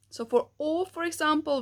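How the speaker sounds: ordinary talk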